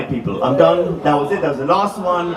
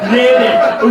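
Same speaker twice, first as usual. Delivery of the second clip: "Nailed it" punched out louder and higher; triumphant, louder "Nailed it"; louder triumphant repeat; 3 semitones higher, 6 dB louder than usual. Acted. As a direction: hit "Nailed it" hard the second time, like a victory shout.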